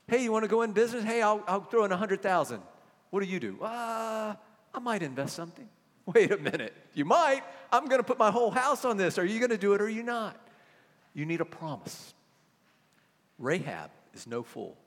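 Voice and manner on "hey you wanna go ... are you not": acted business dialogue. Acted business partner exchange.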